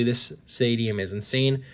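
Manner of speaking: normal talking voice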